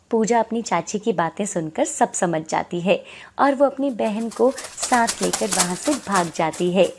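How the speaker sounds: usual speaking voice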